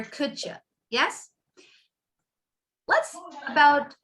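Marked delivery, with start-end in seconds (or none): 0.0-0.6 "could ya" said as "couldja"; reduced. Shown as a demonstration. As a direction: blend the d and y into "ch".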